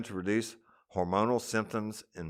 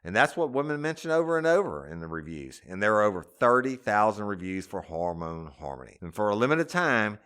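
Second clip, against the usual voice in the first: ordinary delivery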